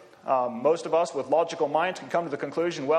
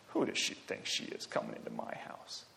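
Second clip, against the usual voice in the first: hushed, sneering mockery, then quicker; a Pharisee mocking the woman under his breath; 6 dB quieter than usual. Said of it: "the kind of voice of mockery".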